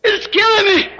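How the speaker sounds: high-pitched cry for mercy, shouted; retold scream. A man waking from a vision screams to God for mercy. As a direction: scream it out as if torn apart inside. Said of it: "looking at the window, screaming".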